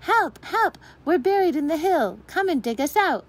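put-on trapped girl yells up to the dancers; yelling for help. A narrator's reading. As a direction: scream for rescue across the clearing. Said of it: "yelled to the dancers".

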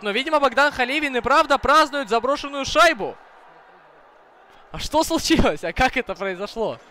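high, loud, urgent goal call; excited hockey goal call. Real, not acted. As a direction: yell it out as the puck goes in. Goal celebration, live commentary.

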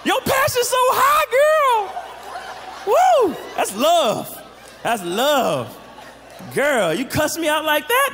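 over-the-top flattered squeal; flattered delight. Pretend. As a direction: gush like she just made your day. Flattered by a jealous girlfriend.

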